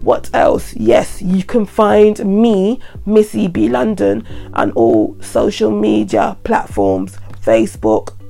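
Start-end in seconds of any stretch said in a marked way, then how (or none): none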